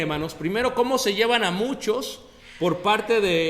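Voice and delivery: loud, high preaching over the congregation; full-force preaching. Acted. Preacher thundering to the brothers about the exile, loud and high.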